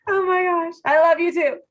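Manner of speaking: overjoyed thanks, almost tearful; touched and delighted. Real, not acted. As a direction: gush back with real affection, voice lifting. Touched by colleague's compliment.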